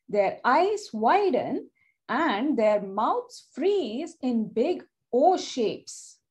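plain reading voice

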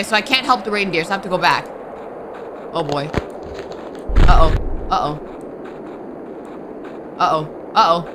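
usual speaking voice

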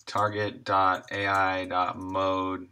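ordinary delivery

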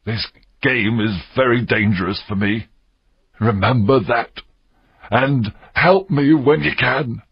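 mildly fearful pirate, measured pace; trembling voice. A narrator's reading. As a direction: plead for help with a slight tremble. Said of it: "Silver's voice trembled".

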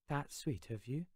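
put-on a soft, lightly Scottish girl's voice; Claire's soft voice. A narrator's reading. Claire softly thanks the boy for his kind fantasy. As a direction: say it gently, touched. Claire answers the narrator gently.

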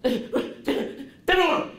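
high shrieking panicked demand; frantic yell. Shown as a demonstration. Teacher showing the wrong way, panicked high shout. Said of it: "What's this?".

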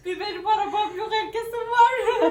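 high, laughing mock lament. Real, not acted. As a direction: laugh through a joking complaint. Joking complaint about no car.

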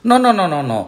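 high, loud string of refusals; exasperated lion correcting the monkey; impatient. A narrator's reading. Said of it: "said the lion impatiently".

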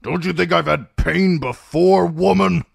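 put-on irritable old man, building from grumble to loud high outburst; snapping. A narrator's reading. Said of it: "Kreb snapped".

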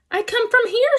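high, level robot voice; storybook robot on a high, even note. A narrator's reading. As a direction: answer as a robot, pitched well up. Robot character answers the animals.

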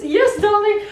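posh-sounding mock accent, sing-song; put-on accent. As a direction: toss it off in a playful fake accent. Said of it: "I was going to an accent".

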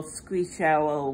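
ordinary delivery

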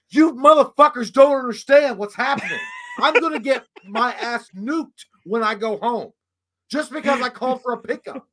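high, loud re-enacted rant; exasperated outburst. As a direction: yell it in desperate frustration at your friends. His exasperated outburst, retold.

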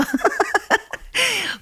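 high-pitched burst of laughter; laughing. Real, not acted. Host breaks out of her ASMR parody, real high laugh. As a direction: crack up at your own silliness.

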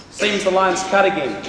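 ordinary talk, nothing marked

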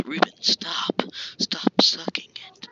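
urgent hushed whisper; breathy whispered scolding. Real, not acted. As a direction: hiss it so nobody else hears. Telling friend to stop, hushed.

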